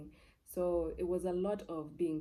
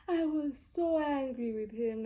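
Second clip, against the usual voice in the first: high, slow, louder emphasis; emphatic recalled anger; 8 semitones higher, 6 dB louder, slower than usual. Real, not acted. Recalling anger at her dead father, emphatic. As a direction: lean slowly on how angry you were.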